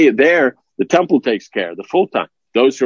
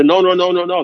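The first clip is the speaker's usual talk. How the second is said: mock protest; 7 semitones higher, 4 dB louder than usual.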